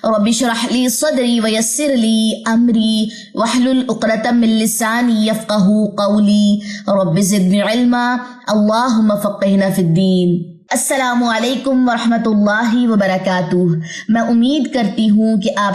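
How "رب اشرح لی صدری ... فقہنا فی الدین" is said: slow, measured recitation of the opening dua; slow Arabic prayer recitation; recited prayer. Acted. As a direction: chant it devoutly and unhurried.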